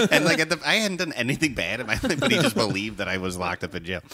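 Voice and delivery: ordinary talk, nothing marked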